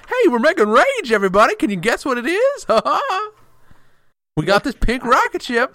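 high, loud goofy hype voice; hammed-up pitchman with a cackle; clown PR person. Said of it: "some sort of clown P R person".

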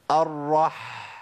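الرح ending in a drawn-out breathy ح; sakin ha. Shown as a demonstration. Teacher showing how the letter flows. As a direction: hold the final ح of الرح. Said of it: "شفت الحاء".

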